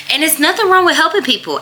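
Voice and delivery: high, fast insistence on wrong; pushing the point hard and quick; emphatic insistence. Real, not acted. Insisting to viewers there's nothing wrong with helping, emphatic. Emphatic self-defence in vlog.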